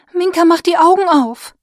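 urgent loud call. Acted. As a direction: shout her awake, hitting Augen. Calling to wake Minka.

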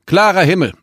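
"klarer Himmel" high and loud through a crackling speaker; over a radio. A narrator's reading. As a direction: tower radio reading "klarer Himmel", falling at the end. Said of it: "Es knisterte im Lautsprecher".

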